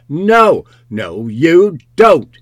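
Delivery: loud, high cry of refusal; shouted, desperate no; sharp cry. A narrator's reading. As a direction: cry out in protest, stretching the words. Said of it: "Then a sharp cry of".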